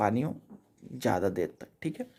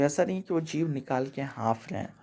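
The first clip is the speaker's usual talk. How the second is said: ordinary talk, nothing marked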